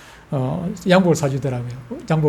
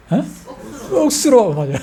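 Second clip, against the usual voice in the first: ordinary talk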